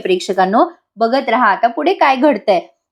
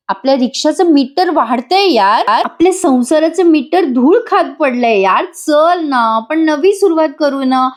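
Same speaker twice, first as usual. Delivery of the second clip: higher, coaxing appeal; pleading; 4 semitones higher than usual. Acted. Jiva begs Nandini for a fresh start, higher-pitched pleading. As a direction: plead with rising pitch, stretching the request.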